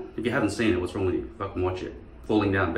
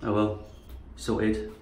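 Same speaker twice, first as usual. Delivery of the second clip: ordinary talk, nothing marked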